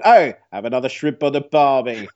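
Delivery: a cheery, overplayed Australian shout-out; mock Australian accent. Bitten surfer jokes as he wades ashore, in a hammed-up Australian accent. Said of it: "I can only imagine".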